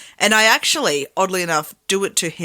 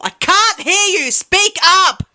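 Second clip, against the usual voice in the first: much higher and louder yell; 12 semitones higher, 5 dB louder, slower than usual. Retold or imitated. Yelling at mumbling kids to speak up, loud and high. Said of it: "I literally start yelling at people".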